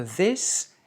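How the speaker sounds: THIS set out clearly, with a voiced TH; careful contrast. Shown as a demonstration. Teacher contrasts this with that, careful word demo. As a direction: say THIS distinctly, as one of two choices. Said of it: "bien faire la différence entre".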